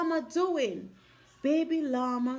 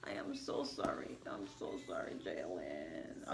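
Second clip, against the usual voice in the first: whispered, softer apology; real whisper; 17 dB quieter than usual. Real, not acted. Mother whispering sorry to hurt child Jalen. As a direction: breathe a hushed sorry.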